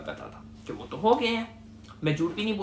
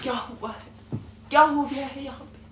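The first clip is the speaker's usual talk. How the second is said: fake groggy waking; 7 semitones higher than usual. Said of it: "मैं जाग गया".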